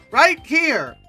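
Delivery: ordinary talk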